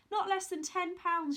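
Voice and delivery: loud, high call after someone; bawled after him. Acted. Mother calling after Jack as he leaves, bawling. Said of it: "she bawled after him".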